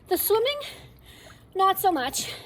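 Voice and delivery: panting, words pushed out loud and high; gasping for air after laps; out of breath. Real, not acted. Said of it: "I'm already gassed".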